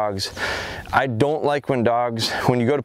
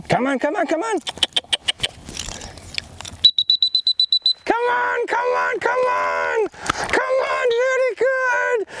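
very high, drawn-out come on; squeaky coaxing recall =108; high recall call. Real, not acted. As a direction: call the puppy back in a very high voice. Recall call to distracted puppy.

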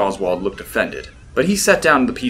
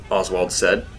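ordinary delivery, nothing marked

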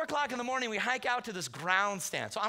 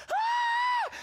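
very high, loud shriek; hammed-up scream of terror; imitated scream; 6 dB louder than usual. Hunter charged by a wounded deer, mock terrified scream.

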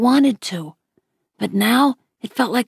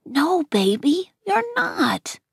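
tender reassurance; 7 semitones higher, slower than usual. A narrator's reading. Mom comforting crying son.

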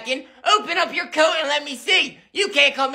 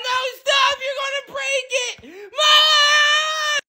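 put-on screaming kid; 11 semitones higher, slower than usual. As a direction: shriek for Mom in panic. Kid screaming during the fight.

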